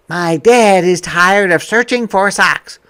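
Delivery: bright, lively picture-book voice; reading to children. Acted. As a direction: read it out to a class of kids.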